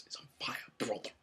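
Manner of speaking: whispered Hulk Hogan catchphrase. Retold or imitated. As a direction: breathe it out like Hogan, half-voiced. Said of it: "Hogan's complaining and goes".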